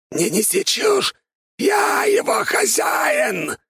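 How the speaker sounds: high, slow otherworldly screech; shrieking skeleton. A narrator's reading. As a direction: scream it like a desperate undead. Furious skeleton retorts.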